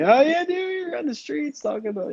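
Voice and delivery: high, exaggerated mocking voice. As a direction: put on a silly voice way up high. Mimicking someone in mock voice.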